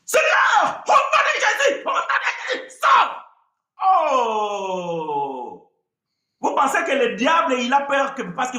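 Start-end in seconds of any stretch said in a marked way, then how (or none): 0.0-3.3 much higher, loud, shrieking command; hammed-up exorcism shriek; mock screaming deliverance. Shown as a demonstration. Si vous disiez comme ça. 3.8-5.7 hammed-up sob-shout; mock wail. Mock wailing after exorcism.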